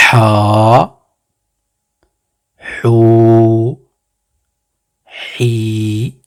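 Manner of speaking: ha, hu, hi each held long; teacher drawing out ha, hu, hi; long vowels. Shown as a demonstration. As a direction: stretch every vowel out. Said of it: "harakat prolongé".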